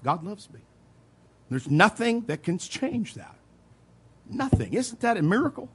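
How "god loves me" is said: said softly to yourself. Shown as a demonstration. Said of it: "You can say it to yourself".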